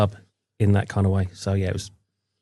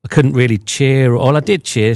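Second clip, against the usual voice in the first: ordinary talk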